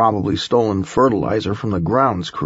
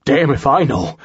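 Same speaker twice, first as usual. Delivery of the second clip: high, urgent gasped reply; 4 semitones higher, 3 dB louder than usual. A narrator's reading. Man answering just after clambering from the shaft, gasping. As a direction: gasp it out with the pitch up. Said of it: "I gasped".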